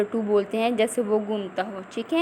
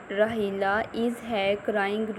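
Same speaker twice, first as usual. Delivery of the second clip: slow reading aloud; 5 dB quieter, much slower than usual. Shown as a demonstration. Teacher repeats line slowly.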